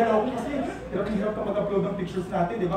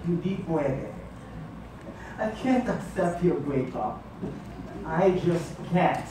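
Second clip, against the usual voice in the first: low, slow and subdued; subdued refusal; 5 semitones lower, 6 dB quieter, much slower than usual. Acted. Jilted partner refusing the breakup, low and slow. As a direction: refuse slowly, voice sunk low.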